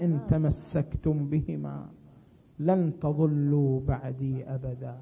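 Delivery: low, measured hadith recitation; solemn recitation. Acted. Preacher reciting the Prophet's words to the congregation, solemn and low. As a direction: recite it gravely, with pauses between phrases.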